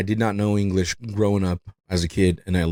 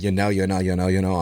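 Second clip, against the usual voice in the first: mock repeated you know; 3 semitones lower, faster than usual. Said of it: "I was like".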